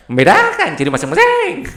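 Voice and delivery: "Membedakan ciri masing-masing" said very high and loud in a Papuan lilt; Papuan accent. Shown as a demonstration. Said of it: "Ngomongnya agak tinggi ya". ("Membedakan ciri masing-masing" dengan logat Papua yang tinggi dan keras)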